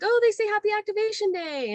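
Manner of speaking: very high, sing-song squeal of delight; delighted translation. Retold or imitated. Worker translating droid beeps for guests, high and delighted. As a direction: coo it high, as if for a child. Voicing what beeping droids say.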